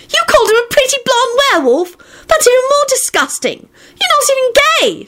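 very high outraged squeal; shriek. A narrator's reading. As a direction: shriek it in disgust. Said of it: "she shrieked".

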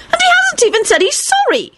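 put-on very high, indignant girlish voice; heated Rosie voice. A narrator's reading. Rosie still fuming to Lupin, very high indignant voice. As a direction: push the outrage up high.

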